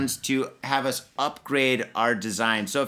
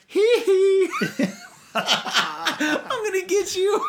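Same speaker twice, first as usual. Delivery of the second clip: [name] impression; much slower than usual. [name] scheming to buy the songs, high giggly falsetto. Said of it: "And [name]'s like".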